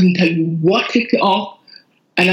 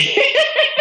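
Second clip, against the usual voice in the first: high laugh. Real, not acted. Laughing after punchline.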